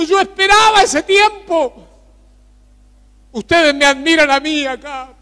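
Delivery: high, loud fervent preaching cry; preaching at full force. Real, not acted. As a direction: lift the prayer into a full-throated sermon. Prayer rises to full preaching.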